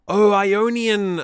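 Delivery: "Ionian" said slowly, high and loud, with stress on the wrong syllable; wrong emphasis. Real, not acted. Realizing a forgotten mode, misplaced stress on Ionian. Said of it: "I put the emphasis on the wrong syllable".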